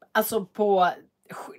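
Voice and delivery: normal talking voice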